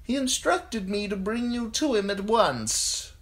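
high, level, put-on voice; messenger's voice. A narrator's reading. Messenger's summons, high monotone voice.

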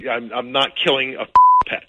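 ordinary talk, nothing marked

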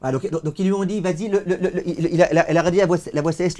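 loud, declaiming reading of the sacred text with translation; fervent text reading. Acted. Teacher reading the heavenly voice's message to students, declaiming. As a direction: proclaim the heavenly voice's words to the class.

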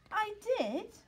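high, hurt insistence; a small dog begging to be believed; pleading pup. Acted. Sid insists to classmates.

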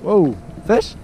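usual speaking voice

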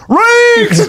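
high-pitched shout of a name; imitated holler. Man at the counter spotting the comic, imitated holler. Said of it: "I hear him at the counter".

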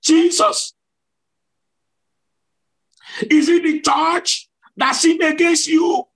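very high, desperate-sounding cry; retold shout. Preacher retells his third question to Jesus, high shouted plea. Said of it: "At the third time".

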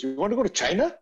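high imitated friend asking; friend's serious offer. Friend inviting him to China, high mock voice. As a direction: replay the surprise invite. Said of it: "He goes".

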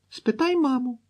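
put-on very high little-girl dare; challenging child. A narrator's reading. Girl dares sister to ask mother, childish challenge. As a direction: pipe up to prove it to a sister.

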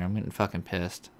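normal talking voice

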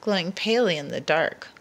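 usual speaking voice

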